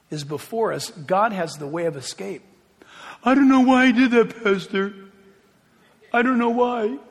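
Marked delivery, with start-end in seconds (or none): 3.2-7.0 higher, louder pleading to the pastor; baffled congregant. Acted. Congregant confessing to the pastor, baffled and pleading. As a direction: act out a helpless confession, pitched up.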